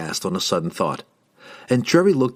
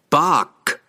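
higher, louder call across the slope; calling loudly; 6 semitones higher, 4 dB louder than usual. A narrator's reading. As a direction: call the golem out from the rocks. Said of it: "Sidney called again".

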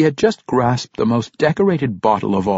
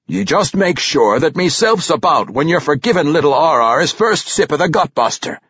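higher, earnest dwarf voice; hearty dwarf king, mock-solemn; 6 semitones higher than usual. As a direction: instruct the guard commander as a folksy king.

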